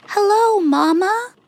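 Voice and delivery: put-on high, eager kid goats greeting mama; little goats. A narrator's reading. Seven little goats greet their mother, high and eager. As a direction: chirp it up high like small goat kids. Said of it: "said the seven little goats".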